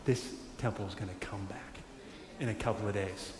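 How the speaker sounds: a hint of a quiet confident Jesus; quiet promise. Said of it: "Jesus is like".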